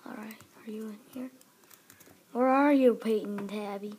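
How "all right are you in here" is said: quick hushed whisper. Acted. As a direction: whisper it while sneaking. Sneaking up in a game.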